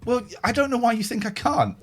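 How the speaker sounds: ordinary talk, nothing marked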